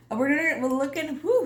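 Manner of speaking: stumbling over the words; tripping on words, restarting; stuttering. Real, not acted. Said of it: "I'm stuttering".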